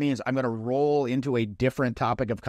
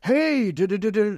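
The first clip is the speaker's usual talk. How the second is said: much higher, louder eager gabble; charging in; 11 semitones higher, 5 dB louder than usual. Retold or imitated. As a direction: rush the mumbled opener like charging up. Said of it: "charge up to 'em".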